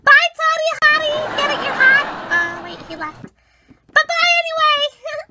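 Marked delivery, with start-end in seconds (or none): 0.0-2.0 high, loud flirty call; gushing goodbye. Acted. Becky calling after her departing crush, high and giddy. 3.9-5.3 high sing-out call; giddy farewell. Acted. Becky calling after crush who already left, high giddy farewell.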